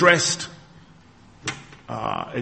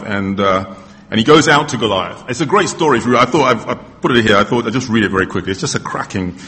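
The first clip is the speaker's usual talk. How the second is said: ordinary talk, nothing marked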